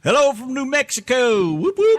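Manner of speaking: high loud cheerful shout-out; excited greeting. Real, not acted. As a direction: hype up the listener. Reading listener greeting excitedly.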